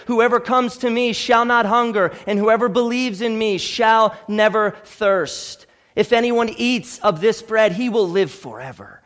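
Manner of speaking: ordinary talk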